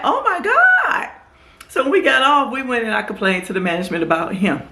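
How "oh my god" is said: very high, slow drawn-out exasperation; exasperated disbelief. Retold or imitated. The vlogger reacting to being told to keep the jacket on, very high and drawn out. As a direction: stretch it like you can't believe it. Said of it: "I'm like".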